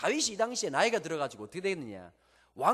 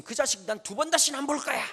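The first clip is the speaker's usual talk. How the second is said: high, loud outburst of a wounded king; angry yell swearing never to see him; furious shout; 8 semitones higher, 7 dB louder than usual. Acted. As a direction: bark it like a father cutting off his son. David furious at Absalom.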